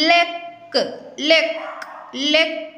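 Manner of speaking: ലെക്ക് with the final k sound hit hard and clear; teacher models ലെക്ക് for pupils, stressing the final k; stressed final k. Shown as a demonstration. ലെക്ക് എന്നതിൽ അവസാന ക് ശക്തമായി ഉച്ചരിക്കുക. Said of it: "കുറച്ച് stress ചെയ്ത് പറയണം".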